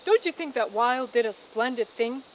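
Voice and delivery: usual speaking voice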